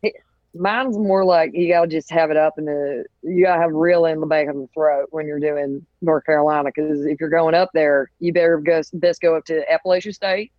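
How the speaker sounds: understated North Carolina twang; North Carolina accent. Shown as a demonstration. Co-host showing the comic how it's done, North Carolina accent. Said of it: "I can do North Carolina".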